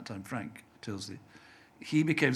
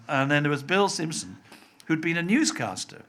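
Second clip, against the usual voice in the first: ordinary talk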